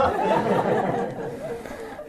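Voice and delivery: a high, breathy burst of nervous laughter; sheepish giggle before the timeout; embarrassed laugh. Real, not acted. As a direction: laugh at yourself, caught out. Nervous about telling the story.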